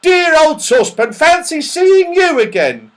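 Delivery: high, loud delighted greeting; yelling. Acted. Mister What's-his-name seeing his friend again, yelling happily. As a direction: exclaim joyfully to an old friend. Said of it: "he yelled".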